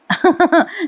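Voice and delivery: short high giggle; real light chuckle; amused chuckle. Real, not acted. Narrator chuckles at the scene.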